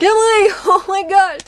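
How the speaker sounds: mock-scandalized friends gasping her name; laughing shocked reaction. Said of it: "always like".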